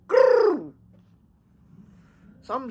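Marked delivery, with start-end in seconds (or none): 0.1-0.7 high, loud trilled "brrr"; punchy vocal ringtone; imitated phone ring. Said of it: "I got that bear call".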